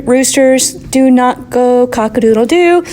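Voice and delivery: ordinary talk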